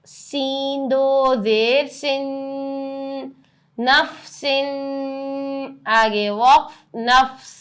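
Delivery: slow sing-song letter-by-letter spelling; qaida spelling drill. Acted. Teacher spelling words aloud for her students in a drill. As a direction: chant each letter and vowel for pupils to copy.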